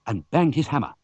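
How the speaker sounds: ordinary delivery, nothing marked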